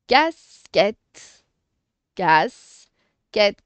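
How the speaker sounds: "Casquette" in two loud separate beats; syllable by syllable. Shown as a demonstration. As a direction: pause between "Cas" and "quette". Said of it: "on fait toujours les syllabes".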